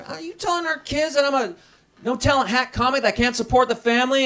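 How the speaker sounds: high, indignant wife demanding answers; mock angry wife. Wife scolding husband in front of kids, high and indignant. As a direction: play the offended wife confronting her husband. Wife confronting him angrily.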